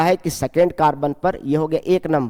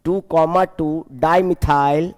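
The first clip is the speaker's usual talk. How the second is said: slow dictation; slower than usual. Shown as a demonstration. Teacher dictating the correct name.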